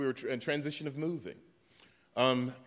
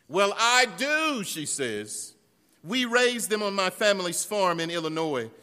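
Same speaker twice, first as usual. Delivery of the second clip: higher, louder voicing of Mary's letter; reading Mary's email; 5 semitones higher, 8 dB louder than usual. Preacher reads a church member's email aloud, lively and higher. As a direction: read her email in a lively voice. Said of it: "Mary writes".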